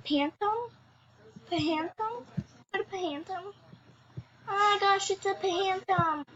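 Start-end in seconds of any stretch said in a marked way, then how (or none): none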